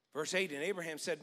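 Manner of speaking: ordinary delivery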